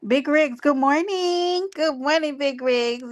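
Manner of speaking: high, slow, drawn-out good morning; playful high greeting to Big Rigs on the stream; sing-song greeting. Real, not acted. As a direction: sing the hello to a friend. Greeting a fellow streamer warmly.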